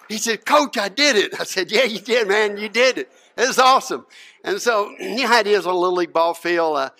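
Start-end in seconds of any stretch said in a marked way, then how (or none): 0.4-1.4 put-on thrilled boy calling to his coach; higher, louder, proud outburst; exultant. 1.7-4.0 loud, higher, delighted coach; excited, emphatic praise; celebrating. Retold or imitated.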